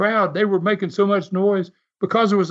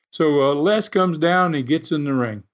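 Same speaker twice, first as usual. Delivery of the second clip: normal talking voice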